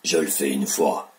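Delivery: deep ogre voice. Shown as a demonstration. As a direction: speak low like an ogre. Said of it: "une voix d'ocre".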